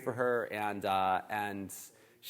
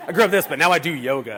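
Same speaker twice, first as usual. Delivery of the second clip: lightly mocked local, louder and higher; amused imitation, pitched up and loud; North Park local; 5 semitones higher, 12 dB louder than usual. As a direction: gently send up a yoga convert. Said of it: "the funny thing people in North Park will say".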